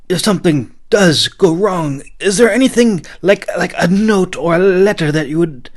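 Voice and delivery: high, loud mock-serious offer; Shade's knight voice. A narrator's reading. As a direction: play it mock-solemn to rattle him. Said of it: "he offered".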